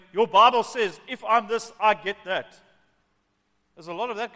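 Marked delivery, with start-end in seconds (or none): 0.1-2.6 loud, higher-pitched entitled insistence; demanding prayer. Acted. Imitating believers who claim promises from God, loud and demanding. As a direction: mimic someone demanding what they're owed.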